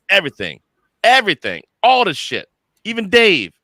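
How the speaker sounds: rising from plain to a high, loud cry; building excitement. Real, not acted. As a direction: build it up bigger each time. Building sign-off rant.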